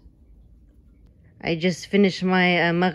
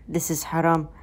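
ordinary delivery

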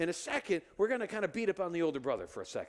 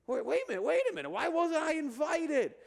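put-on older brother, indignant; 9 semitones higher than usual. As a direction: act out the brother catching on, outraged. Older brother discovers the party.

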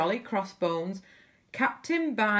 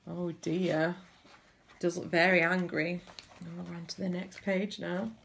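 ordinary delivery, nothing marked

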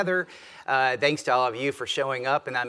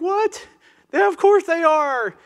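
much higher, mocking disbelief; skeptical audience voice; 7 dB louder than usual. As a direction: play the doubting crowd, sarcastic. Voicing the audience's skeptical reaction.

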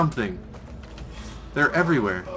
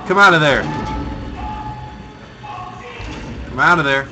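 urgent calling; 4 semitones higher, 5 dB louder, faster than usual. Real, not acted. Urging the game character.

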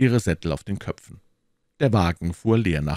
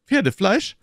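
high, loud, rising "Pferdefleisch?"; revolted, incredulous query; disgusted question; 5 dB louder than usual. A narrator's reading. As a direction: ask it in appalled disbelief. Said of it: "fragte Aramis mit einer Grimasse des Ekels".

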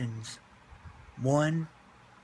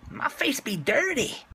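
squeaky cartoon voice, much higher; high silly voice; 12 semitones higher than usual. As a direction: pitch way up like a cartoon. Silly character voice.

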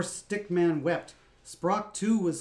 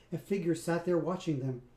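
ordinary delivery

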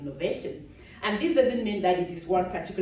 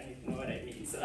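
usual speaking voice

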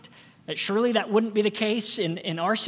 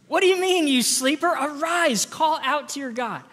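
loud, high, rousing shout at the sleeper; captain yelling; 6 semitones higher, 7 dB louder than usual. Acted. Ship captain shouting Jonah awake below deck, loud and high. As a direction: bellow it like a captain in a storm.

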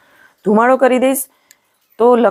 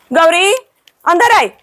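calling the cow; 8 semitones higher than usual. Real, not acted. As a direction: call her in from a distance. Calling cow Gauri inside.

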